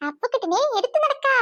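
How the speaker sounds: ordinary talk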